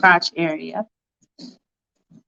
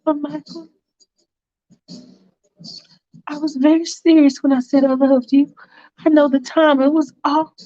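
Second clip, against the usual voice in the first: put-on higher girlfriend voice, earnest love plea; Faith pleading; 6 semitones higher than usual. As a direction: lift the pitch and plead with him sincerely.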